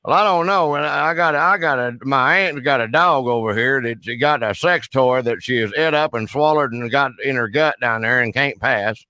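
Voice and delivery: hammed-up backwoods Southern caller; put-on rural Southern accent. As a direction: play a blustering Southern good old boy on the phone. Roy Mercer character voice held.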